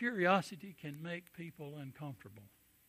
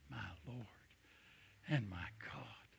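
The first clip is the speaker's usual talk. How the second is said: hushed whisper; 5 semitones lower, 13 dB quieter than usual. Acted. Reverent quotation of Thomas.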